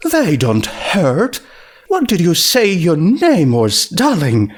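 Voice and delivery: a touch of a refined lady asking sweetly; lightly voiced posh mare; Rarity voice. A narrator's reading. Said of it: "Rarity voiced in the story".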